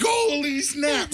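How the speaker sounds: slow, high mock shock. Seeing the eight hundred dollar bill, slow high shock. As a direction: drag it out, stunned.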